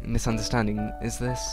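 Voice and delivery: ordinary talk, nothing marked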